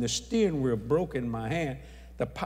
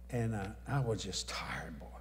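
tired whisper; 5 semitones lower, 8 dB quieter than usual. Real, not acted. Telling George of exhaustion, whispered. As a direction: breathe it out, worn out. Worn-out admission.

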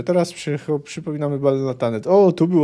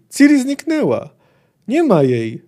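raised, alarmed report; acted yell; 8 semitones higher, 5 dB louder than usual. A narrator's reading. Breathless Jaskier reports Ciri missing to Geralt, mildly alarmed yell. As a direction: break bad news loudly, voice lifted at the start. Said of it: "wrzasnął po chwili".

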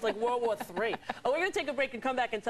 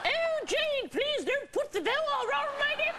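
high, prim posh-lady voice; mock a prim British mother; hammed-up high prim old lady; proper British lady; slower than usual. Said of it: "She's so proper and British".

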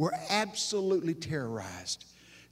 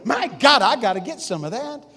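much higher and louder, gushing; mock eager viewer; 8 semitones higher, 10 dB louder than usual. Preacher imitates a viewer hooked by the ad, high and excited. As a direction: play the sucker who wants it now. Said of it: "but you're going".

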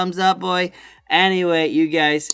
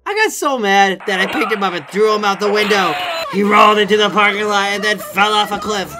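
loud, high shouted fantasy; mock rage rant; 5 semitones higher, 5 dB louder than usual. Worker shouting a made-up revenge on the customer, loud and laughing.

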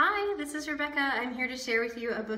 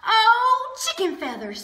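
dismayed exclamation; 10 semitones higher, 7 dB louder than usual. Acted.

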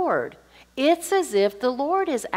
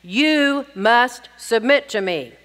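slow, emphatic demand, each word weighted; domineering husband; 4 dB louder, slower than usual. Acted. Husband wrongly ordering his wife to submit, domineering. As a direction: lay down the law, slowly and louder. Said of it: "then the husband can say ... the tone here".

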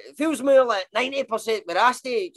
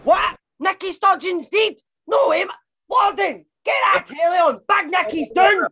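high-pitched, urgent disbelief; shocked alarm; 8 semitones higher, 4 dB louder than usual. Acted. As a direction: react to shocking news and raise the alarm. Shocked by supposed death of leader.

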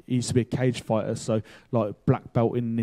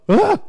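loud, very high cry of shock; sudden high yelp, acting out alarm; shocked gasp; 8 dB louder than usual. Teller reacting to stranger's defiance, shocked yelp.

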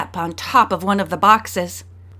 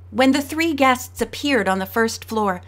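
ordinary delivery, nothing marked

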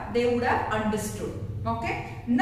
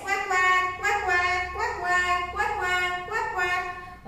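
duck quack; 5 semitones higher, slower than usual. Shown as a demonstration. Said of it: "What does the duck say?".

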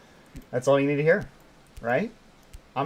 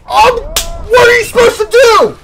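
very high, loud wail; shrieking, near-tearful gamer rage; frustrated screaming; 14 dB louder than usual. Real, not acted. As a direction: scream the question in desperation.

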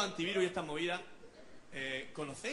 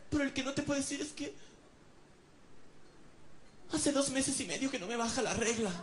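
put-on high coy girlfriend voice; 7 semitones higher than usual. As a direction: confess it like a shy girlfriend. (voz aguda de novia tímida)

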